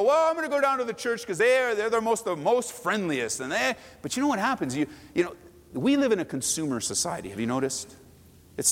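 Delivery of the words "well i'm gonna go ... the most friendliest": huffy churchgoer. Retold or imitated. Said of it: "then you go".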